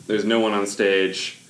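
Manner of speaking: ordinary delivery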